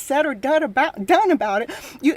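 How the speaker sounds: usual speaking voice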